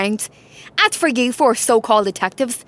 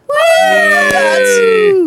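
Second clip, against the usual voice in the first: high, long, loud whoop; celebratory cheer; 10 semitones higher, 5 dB louder than usual. Acted. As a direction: cheer in triumph, drawn out. Detectives celebrate solving the crime.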